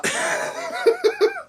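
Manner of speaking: high burst of laughter; breathy high giggle. Real, not acted. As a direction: laugh at the absurdity. Laughing at own complaint.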